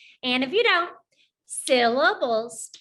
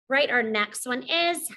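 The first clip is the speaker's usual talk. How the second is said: normal talking voice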